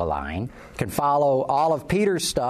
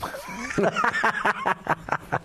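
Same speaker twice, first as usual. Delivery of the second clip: laughing; 12 semitones higher than usual. Real, not acted. Laughing at downer ending.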